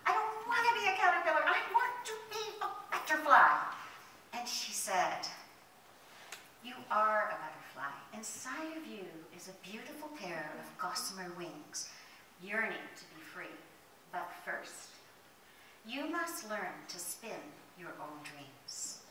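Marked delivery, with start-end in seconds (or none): 0.0-3.7 high, loud tantrum; storming mad. Acted. Caterpillar protests to the fairy after the last wish, storming mad. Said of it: "he is just storming mad". 6.6-19.0 soft, low, measured wisdom; slow down and reassure gently; tender reassurance. Acted. Leaf fairy tells caterpillar of wings inside, tender reassurance.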